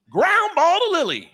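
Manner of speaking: chirpy coach calling the play; mock coach call. Coach calling the play to the girls, high and loud.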